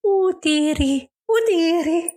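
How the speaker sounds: put-on high, slow old nanny voice; drawn-out high nanny impression; Mrs Doubtfire voice.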